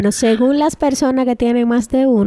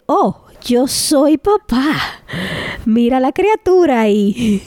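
slow, higher, lightly mocking new-father wonder; a hint of a dazed new dad marveling; teasing imitation of the friend as a new dad; imitating a new dad; 3 semitones higher, slower than usual. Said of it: "que tú dijiste".

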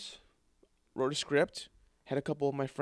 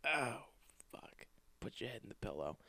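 muffled defeated mutter; 10 dB quieter than usual. Retold or imitated. Defeated, face in pillow.

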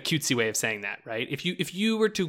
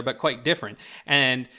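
ordinary talk, nothing marked

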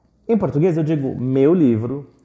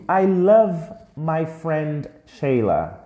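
slow model sentence; 3 semitones higher, slower than usual. Shown as a demonstration. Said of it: "Repete comigo".

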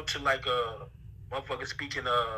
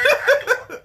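"Hot dog" squealed much higher and louder in a hammed-up New York accent; mock New York accent; 10 dB louder than usual. A Southerner teasing his New York friends, mock New York accent. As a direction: shriek out "Hot dog" imitating a New Yorker. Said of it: "See how y'all say this shit?".

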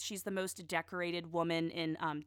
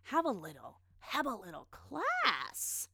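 slow, drawn-out sassy scold; 4 semitones higher, slower than usual. Pretend. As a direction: stretch out class like a put-down. Scolding the celebrity.

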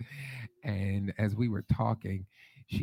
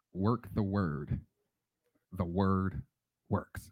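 slow, softer and lower; measured motto; 5 semitones lower, 5 dB quieter, much slower than usual. Real, not acted. Preacher passing on a motto carried since, slow and soft. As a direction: say it slowly, softly, word by word.

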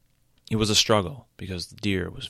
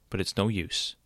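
plain reading voice

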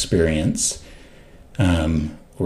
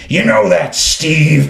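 loud, higher mock rough growl of a gossipy old client; imitating Mark's rough voice; 10 semitones higher, 7 dB louder, slower than usual. Telling a friend about client Mark, loud rough imitation. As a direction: sound like you're about to say something nasty. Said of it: "he had this rough voice".

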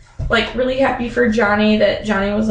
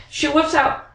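ordinary talk, nothing marked

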